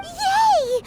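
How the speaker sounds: high, loud happy squeal; excited cheer. Acted. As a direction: cheer like a delighted child. Cheering at promised treat.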